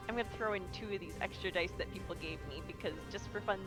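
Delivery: ordinary talk, nothing marked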